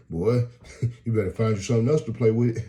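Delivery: a street guy warns the visitor off, loud and fast; loud warning. Retold or imitated. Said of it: "He gonna stop laughing like".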